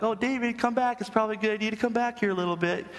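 high, quick, unbothered sing-song; mock casual pleading. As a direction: imitate a parent too mild to warn urgently. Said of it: "If I didn't love him, I'd be like".

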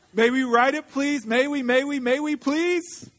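put-on high, insistent child's plea, building; eager begging. A narrator's reading. Cubs pestering Papa for a ride, begging excitedly. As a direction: beg and beg, stretching the last please.

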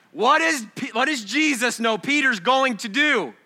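high, loud and ringing; booming pulpit question; loud preaching. Real, not acted. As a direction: throw the question out to the room. Question re-asked with force.

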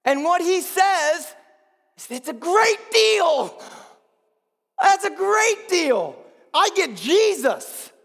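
high, loud, drawn-out proclamation; exultant preacher at full cry; climactic full-force preaching. Acted. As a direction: shout the good news from the pulpit.

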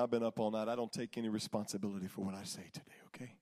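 ordinary talk, nothing marked

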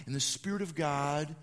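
normal talking voice

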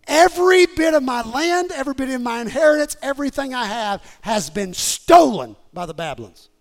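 loud outcry over a loss; full-force preaching. Acted. Preacher voicing Jeremiah's stolen inheritance, loud impassioned preaching. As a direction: cry it out as the robbed prophet.

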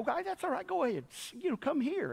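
high, hushed soothing voice; sympathetic wife. Retold or imitated. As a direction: softly coax a child closer as the sympathetic wife. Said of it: "my wife was very sympathetic".

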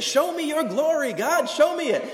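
high, loud pleading cry to God; calling out. Retold or imitated. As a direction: shout it up toward heaven, begging. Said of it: "He's just calling out".

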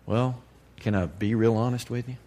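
ordinary talk

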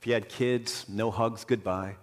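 low, soft and subdued; hushed, sorrowful. Real, not acted. Preacher telling of a leper leaving his children, hushed. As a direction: drop down softly on each small loss.

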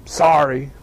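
put-on low mumbled sulky apology, a kid forced to say it; a kid muttering sorry to little Joey because he has to; grudging kid sorry. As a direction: drag it out like a sulking child. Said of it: "they walk back over like this".

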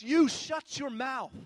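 forceful put-on telling-off; strong rebuke. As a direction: hit each word hard, as a scolding. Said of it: "a word that's actually really strong".

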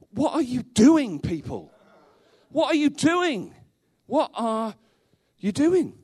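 pleading with wayward converts in exasperation; frustrated outburst. Retold or imitated. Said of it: "Paul's frustration is this".

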